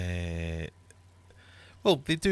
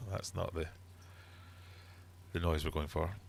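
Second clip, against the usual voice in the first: ordinary talk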